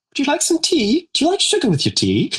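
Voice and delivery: high, sweet hostess voice; posh tea lady. Acted. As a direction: offer tea in a dainty high voice. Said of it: "and she goes".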